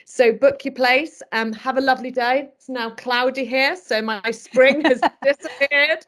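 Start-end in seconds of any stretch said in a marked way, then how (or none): 3.8-6.0 real laugh breaking into the words. Real, not acted. Host signing off jokes about the clouded-over day, laughing.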